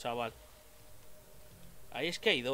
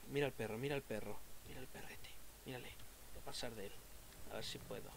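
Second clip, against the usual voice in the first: soft, half-whispered words while sneaking past; tense hushed murmur; 6 semitones lower, 16 dB quieter, faster than usual. Real, not acted. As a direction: keep it low so the dogs won't hear. Sneaking past dogs in game.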